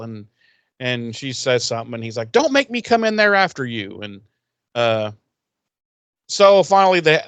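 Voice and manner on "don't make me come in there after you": high-pitched, prissy scolding voice; scolding Wes. Retold or imitated. Wes scolds Cordelia to climb out of the vat, in a high prissy voice. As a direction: play up a fussy schoolmarm telling her off. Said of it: "scalding Cordelia".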